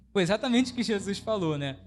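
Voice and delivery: ordinary talk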